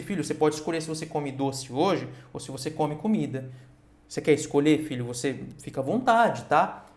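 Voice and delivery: lightly voiced indulgent mum or dad. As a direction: coax the child like a pushover parent. Permissive parent voiced, set against strict.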